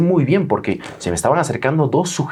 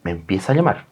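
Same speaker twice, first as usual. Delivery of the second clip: normal talking voice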